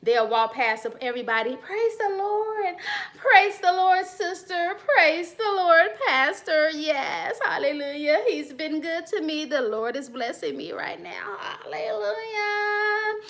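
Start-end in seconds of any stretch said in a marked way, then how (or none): 1.6-13.2 much higher, sing-song holy greeting; fake churchy greeting. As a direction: ham up the sugary church lady. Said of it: "trying to play churchy".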